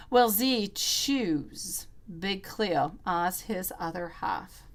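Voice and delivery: drawn out, solemn question to the pendulum; slow, deliberate question. Real, not acted. Tarot reader asks the pendulum about a viewer's crush, slow and deliberate. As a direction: ask the pendulum, dragging each word.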